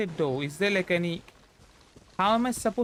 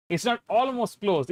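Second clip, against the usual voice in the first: ordinary talk, nothing marked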